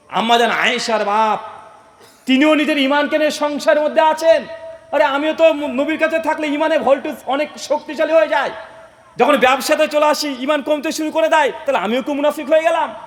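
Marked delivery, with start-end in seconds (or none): 2.3-12.9 high, urgent declamation; impassioned preaching. Acted. Abu Bakr admitting the same doubt to Hanzala, impassioned. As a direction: voice the doubt in a raised preacher's cry.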